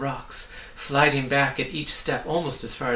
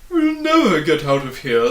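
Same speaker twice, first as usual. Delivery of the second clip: louder, despairing moan; 3 semitones higher, 10 dB louder than usual. A narrator's reading. Humbug stuck in the pit, moaning. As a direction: wail at the steep walls. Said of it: "the humbug moaned".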